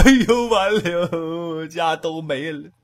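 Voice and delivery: slow, wailing 哎呦 of a servant whose house is robbed; crying servant. Acted. Servant alone in the robbed yard, slow lament. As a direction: servant cries out over his emptied house, slow and wailing. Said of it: "跟这哭吧".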